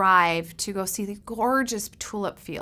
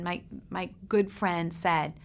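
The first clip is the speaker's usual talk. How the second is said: normal talking voice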